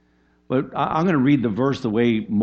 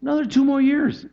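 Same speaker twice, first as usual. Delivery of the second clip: much higher, leaning hard on two; incredulous repeat; 4 dB louder than usual. Real, not acted. Preacher stressing Joseph's extra years in prison, incredulous and high. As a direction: say it again as if unbelievable.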